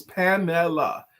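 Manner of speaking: "Pamela" slow, each syllable pa-me-la clear; three syllables. Shown as a demonstration. Host demonstrates the right way to say the name. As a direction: say "Pamela" slowly in three beats. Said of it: "Three syllables".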